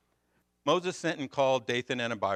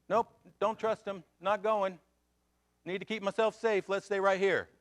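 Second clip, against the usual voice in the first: high-pitched, balking refusal; acted stubborn refusal; 9 semitones higher, 4 dB louder than usual. Preacher as Israelites refusing to go, high stubborn protest.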